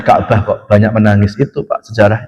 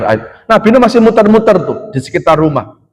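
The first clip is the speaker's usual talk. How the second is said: ordinary delivery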